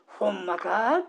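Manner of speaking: 疑わしげに「ほんまか？」と問い返す; 信じられないという調子で二度問う; doubting. Shown as a demonstration. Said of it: "これ疑ってるわけですね". (疑って聞き返す「ほんまか？」)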